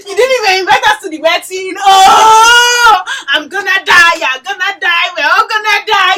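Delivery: shouted, high and loud mock-Nigerian uproar; loud excited exclaiming. Imitating Nigerians reacting to a secret wedding, shouting loud. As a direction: shout it out like an over-the-top auntie. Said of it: "they be like".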